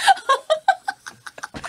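squealing giggle of embarrassment; embarrassed high laugh. Real, not acted. Laughing shyly after hearing the line she must say.

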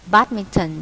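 "badminton" stressed on the first syllable. Shown as a demonstration. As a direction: hit BAD- in "badminton". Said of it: "nhấn âm một".

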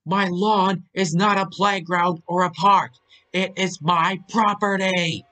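high, loud angry tirade; yelling. A narrator's reading. Old man berating a boy about his lawn, yelling. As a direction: yell it right in his face. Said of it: "still yelling in his face".

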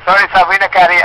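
ordinary talk, nothing marked

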